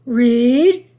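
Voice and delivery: sharp loud command. Acted. Grandmother commanding Julian to read, loud single word. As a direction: order it with a pointed finger. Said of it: "she commanded".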